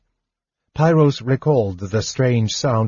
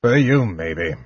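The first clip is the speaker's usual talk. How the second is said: muttered. A narrator's reading. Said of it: "muttered another guard".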